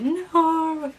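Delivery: high, anguished no; mock-despairing no; dismayed no. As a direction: cry out a shocked no. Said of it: "And I just said".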